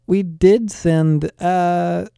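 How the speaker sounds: halting start, stretching into a filler; slow, searching. A narrator's reading. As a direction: feel slowly for the right word. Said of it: "Isaiah began slowly, finding the words".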